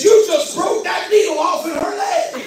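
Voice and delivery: worked-up protective dad; angry accusation. Retold or imitated. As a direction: accuse the nurse in disbelief. Confronts nurse in retold quarrel.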